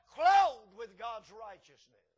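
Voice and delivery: normal talking voice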